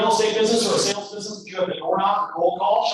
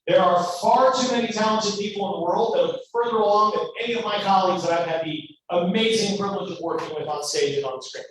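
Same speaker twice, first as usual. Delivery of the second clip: ordinary talk, nothing marked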